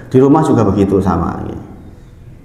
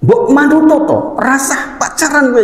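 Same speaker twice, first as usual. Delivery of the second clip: exasperated parent scolding. Retold or imitated. Fed-up father forbidding his son to date, high scolding. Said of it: "jengkel banget".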